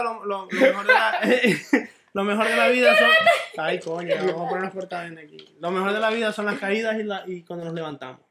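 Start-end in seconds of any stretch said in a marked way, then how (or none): 0.0-3.1 stammering through real laughter, loud; laughing. Real, not acted. Host attempting life advice to friends, laughing. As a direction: try to get a motto out while cracking up.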